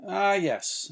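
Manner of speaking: ordinary delivery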